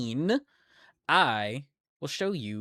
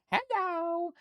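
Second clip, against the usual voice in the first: high sing-song hello. Real, not acted.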